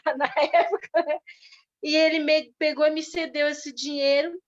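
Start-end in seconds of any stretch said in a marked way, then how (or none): 0.1-1.2 laughing. Real, not acted. Laughs at her old naivety.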